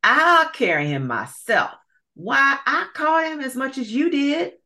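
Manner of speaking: squabbling greedy gator; quarrelling alligator. Acted. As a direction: argue hotly, high pitched. Second alligator arguing.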